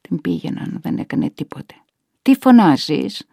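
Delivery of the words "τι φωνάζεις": loud, drawn-out irritated demand; exasperated scolding. A narrator's reading. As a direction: scold an old mother for calling out. Relative scolds the calling mother. (εκνευρισμένη, δυνατή επίπληξη)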